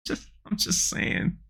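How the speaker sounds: breathy whispered aside, slow. Real, not acted. Vlogger mutters a sly aside about the gun room, whispered. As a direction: drop to a whisper for the joke.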